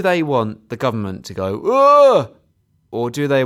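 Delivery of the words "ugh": a loud, high, fed-up "ugh"; exasperated groan. Retold or imitated. Government groaning at the protesters, loud exasperated ugh. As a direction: groan like the whole thing is a nuisance.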